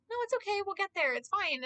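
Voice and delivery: gently calming a stressed driver; calm reassurance. Retold or imitated. Passenger soothing the driver, soft and reassuring. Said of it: "I'm like".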